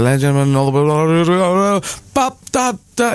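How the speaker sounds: rising mumbled showman patter; announcer tone. Shown as a demonstration. Comic demonstrating how to introduce someone, announcer tone. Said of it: "You just gotta get that tone".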